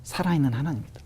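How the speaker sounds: a hint of a low, quiet divine voice; voice of God. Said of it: "누군가가 말하는 것 같은 느낌이 들면서". (마음속에 들린 하나님의 목소리를 조용히 낮게 흉내)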